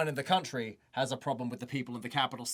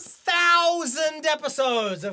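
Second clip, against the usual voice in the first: mock amazement; 13 semitones higher, 8 dB louder, slower than usual. Mocking the episode count.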